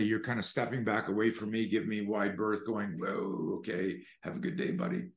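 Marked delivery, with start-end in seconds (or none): 3.0-5.1 hushed, mostly whispered nervous brush-off; wary backing off. Acted. Bystander stepping back from the angry man: nervous whisper. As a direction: ease away from a ranting stranger.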